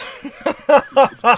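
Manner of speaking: bursting out laughing high; loud high laugh. Real, not acted. Laughs at friend's accent.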